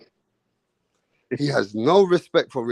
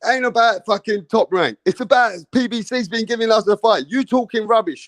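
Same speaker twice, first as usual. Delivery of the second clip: higher, louder argumentative jab; mock heated retort; 6 semitones higher, 3 dB louder than usual. Imitating Mirage arguing with the host, mock heated retort. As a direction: snap back as the heckler.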